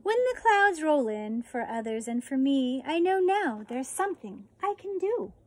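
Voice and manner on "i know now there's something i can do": usual speaking voice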